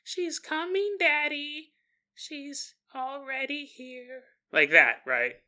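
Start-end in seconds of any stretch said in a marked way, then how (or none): 0.0-4.2 put-on high, soft, eerie little kid voice; ghostly hushed child, drawn out at the end; spooky child. Shown as a demonstration. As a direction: creep out dad in a tiny high voice. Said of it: "in a spooky way. Like, do the little".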